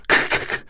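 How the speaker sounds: unvoiced "咳" breaths; trying to shout a consonant; voiceless h. Shown as a demonstration. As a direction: force out silent "咳" puffs. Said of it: "再比如辅音咳".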